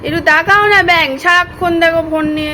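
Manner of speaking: calling out loudly to him. Real, not acted. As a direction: raise your voice to get his attention. Calling to husband on phone.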